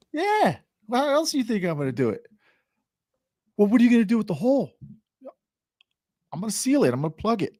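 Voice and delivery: high, brushing-off reply; dismissive stepdad. Retold or imitated. Stepdad to worried customer, high brushing-off voice. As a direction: wave the worry away.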